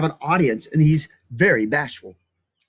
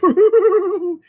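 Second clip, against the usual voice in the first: much higher, loud giggle; high lizard laugh; 6 dB louder than usual. Acted. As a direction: cackle like a little lizard. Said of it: "The lizards laughed".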